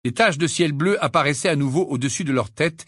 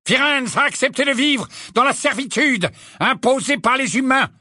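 high, forceful accusation; indignant creature proclaiming a betrayal; outraged centaur; 12 semitones higher, 4 dB louder than usual. A narrator's reading. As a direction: denounce it hotly, voice pitched well up. Angry centaur accuses Firenze.

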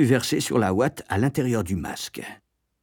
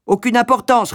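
high, loud dismissal; mother brushing off complaints loudly; sharp retort; 13 semitones higher, 6 dB louder than usual. A narrator's reading. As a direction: cut the objection off forcefully. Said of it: "rétorqua ma mère".

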